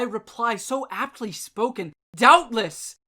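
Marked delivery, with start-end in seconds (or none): 2.1-3.0 a sudden loud "Doubtless"; exclaimed. A narrator's reading. Startled man answering the talking raven, loud. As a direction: burst out with it, startled. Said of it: "said I".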